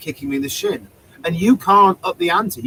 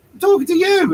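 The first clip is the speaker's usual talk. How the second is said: high-pitched retort; 12 semitones higher, 4 dB louder than usual. Retold or imitated. Performer answering an angry heckler, high retort. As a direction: pitch jumps right up, indignant comeback.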